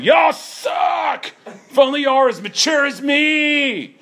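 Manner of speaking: high, loud jeering yell; mocking obnoxious shout. Preacher mimicking Shay taunting Baptists, shouted mockery. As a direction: jeer at the church like a smug heckler.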